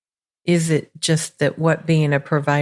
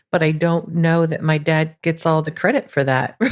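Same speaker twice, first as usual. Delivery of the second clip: ordinary talk, nothing marked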